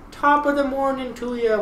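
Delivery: high mock Irish lilt; mock Irish greeting. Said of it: "whenever you guys say".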